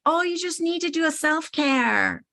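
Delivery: mocking high-pitched LA accent. People telling her to do self-care, mock LA accent. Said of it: "say it in that L A, you know, accent".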